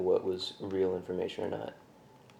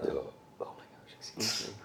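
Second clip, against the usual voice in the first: hushed apology; 3 semitones higher, 8 dB quieter than usual. Real, not acted. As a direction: breathe the apology under your breath. Apologising, likely burp or cough.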